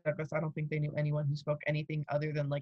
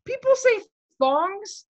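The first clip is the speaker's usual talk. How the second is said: incredulous; 8 dB louder, slower than usual. Real, not acted. Mocking outsiders' word for sandals.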